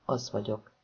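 said quietly. A narrator's reading. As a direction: say it softly and low. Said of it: "mondta Déna csöndesen".

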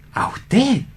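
high, soft disbelieving question; incredulous high squeak. Acted. Hans incredulous at claim.

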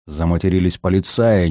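usual speaking voice

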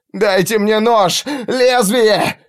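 high, demanding yell; desperate shout. Acted. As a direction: scream for the blade. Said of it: "закричал в ответ солдат". (отчаянный крик солдата)

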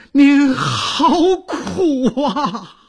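high, drawn-out wail of grief; acted lament for the old mistress; loud weeping. A narrator's reading. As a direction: cry out slowly, voice breaking into tears. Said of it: "刘婆放声大哭".

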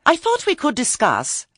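high, loud, indignant protest. A narrator's reading. Lettice objecting to being sent away, protesting. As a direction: object as you are being dismissed. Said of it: "Lettice protested".

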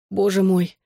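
ordinary delivery

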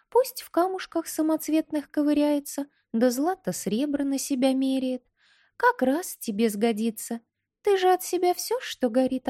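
high, pert little doll voice; bold doll voice. Acted. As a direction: lecture him boldly as a tiny doll. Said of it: "Куколка смотрела смело".

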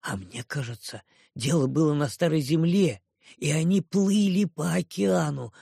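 usual speaking voice

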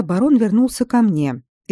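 ordinary delivery, nothing marked

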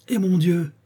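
high-pitched cry of dismay; startled exclamation. A narrator's reading. Lady lifting the sleeper's blanket, startled exclamation. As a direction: exclaim it in sudden shock. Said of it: "s'écria-t-elle en la rejetant aussitôt".

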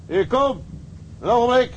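mate calling back to the man next door, loud. Acted. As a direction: call out to someone nearby. Calling out to neighbour.

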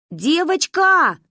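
calling out. A narrator's reading. Girl calls out to the stranger from the car. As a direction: call across to her. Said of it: "позвала я".

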